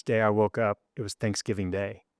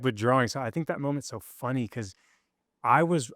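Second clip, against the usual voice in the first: ordinary delivery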